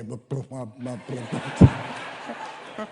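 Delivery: put-on starstruck kid. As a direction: babble like a starstruck seven-year-old. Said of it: "I'm seven years old all over again".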